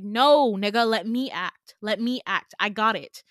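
loud, exasperated outburst; indignant, forceful protest; angry retort. Retold or imitated. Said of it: "You can tell by my anger".

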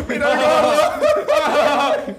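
high, loud and very slow through laughter; overjoyed viewer howling at a video; laughing viewer. Retold or imitated. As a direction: cackle it out like a fan in stitches. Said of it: "él se pone así que".

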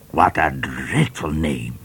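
slow, odd judge voice with a touch of terror; a mild shudder of fright; shivering judge. A narrator's reading. Judge reacting to the name with mild terror. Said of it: "said the judge with a shiver of terror".

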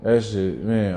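ordinary talk

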